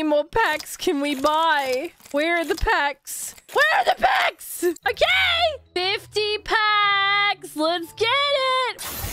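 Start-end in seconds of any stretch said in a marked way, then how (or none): 3.5-4.6 high-pitched panicked shout; high, frantic outcry; frantic yell. Real, not acted. Repeats it as a yell. 4.6-9.1 high, drawn-out battle cry; slow, high hyped yell; hyped shout. Real, not acted. Buys more packs, hyped.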